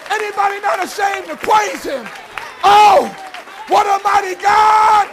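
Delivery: high, loud whooping sermon; rousing preacher calling for a witness; full-force preaching. Acted. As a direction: preach it out to the congregation.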